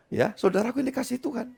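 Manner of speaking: ordinary talk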